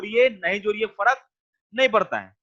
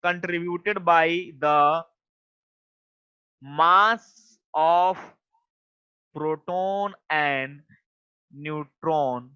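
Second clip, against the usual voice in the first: drawn-out words with long gaps, sinking lower towards the end; slow dictation; 3 semitones lower, much slower than usual. Real, not acted. Teacher writing out a definition for the class, slow dictation. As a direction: dictate at writing speed, piece by piece.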